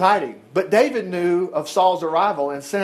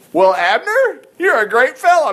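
mildly mocking, raised-pitch taunt; lightly acted jeer across the valley; 6 semitones higher, 5 dB louder than usual. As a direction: needle him with mock praise. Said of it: "David taunted".

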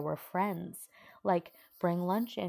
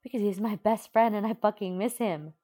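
a loud, higher yell; 5 semitones higher, 8 dB louder than usual. A narrator's reading. Uraraka yells at Bakugo about missing Deku, loud. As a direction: yell it at him. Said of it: "Uraraka yells".

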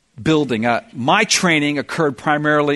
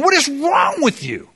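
exasperated shout; 11 semitones higher than usual. Retold or imitated. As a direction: bark it out at someone who keeps failing. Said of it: "they're going".

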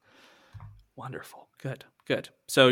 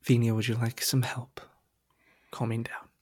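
1 gentle whisper; 4 dB quieter than usual. Acted. Instructor gently calms panicking pilot.

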